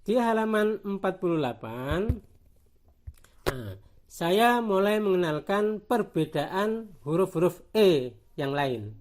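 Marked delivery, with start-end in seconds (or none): none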